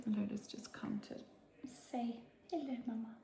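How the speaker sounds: hushed whisper. Real, not acted. As little Lola comes in, a soft whisper. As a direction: keep it hushed, barely voiced. Child arrives, whispering softly.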